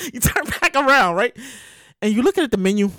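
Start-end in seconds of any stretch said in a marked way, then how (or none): none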